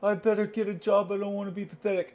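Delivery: high, fast whiny self-mock; mock panic. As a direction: whine like a scared try-hard, pitched high. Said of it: "to go".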